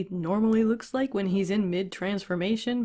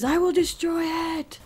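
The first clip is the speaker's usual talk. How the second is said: declaring it like an anime villain; mock battle cry; 8 semitones higher than usual. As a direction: imitate a furious charge, voice pushed up. Character vows destruction in story.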